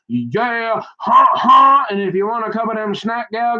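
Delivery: high, worked-up rambling; excited. Real, not acted. Telling Leslie about the booms, all worked up.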